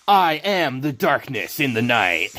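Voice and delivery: slow dark boast; lightly voiced brooding fighter; angry Ryu voice. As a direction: say it like a villain in the shadows. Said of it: "just an angrier Ryu".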